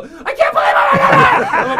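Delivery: very high, loud, drawn-out scream; starstruck shriek. Retold or imitated. Fan about to blurt out before famous people, shrieking. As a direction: lose all control and squeal slowly. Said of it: "I know I'm about to go".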